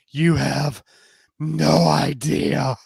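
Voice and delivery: loud, slow, knowing; slow teasing promise; slow sultry tease. Acted. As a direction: draw out no idea seductively. Justine teasing, sliding off stool.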